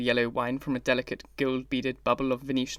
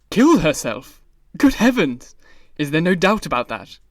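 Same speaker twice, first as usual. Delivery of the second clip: much louder shocked cry; horrified cry; 10 dB louder than usual. A narrator's reading. Basil hearing of the suicide, horrified cry. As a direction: recoil in horror. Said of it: "cried Hallward ... horror".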